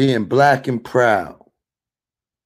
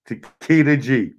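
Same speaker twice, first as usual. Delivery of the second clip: ordinary talk, nothing marked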